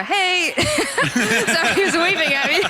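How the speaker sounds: high squeal of hello breaking into laughter; giggly greeting. Real, not acted. Host waving back at someone during housekeeping, giggly and high. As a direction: laugh through a sheepish apology.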